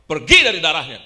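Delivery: explosive exorcist's shout; sudden loud, authoritative command; commanding rebuke. Retold or imitated. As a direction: cast it out at full volume. Retold rebuke casting out the cancer.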